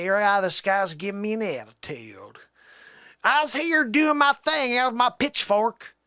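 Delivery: loud, high, hammed-up Southern twang; Southern accent. Country fellow complaining about attitude, Southern accent. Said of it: "never really done a Southern accent before".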